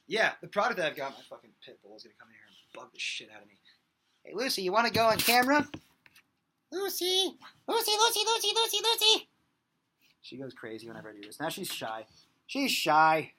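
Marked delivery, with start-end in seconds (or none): 4.2-9.3 high, excited dog-calling voice. Real, not acted.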